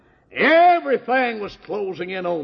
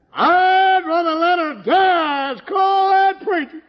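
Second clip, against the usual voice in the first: high, loud, slow, tearful yell; desperate husband refusing to call preacher; crying shout; 12 semitones higher, 6 dB louder than usual. Retold or imitated. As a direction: cry it out in despair.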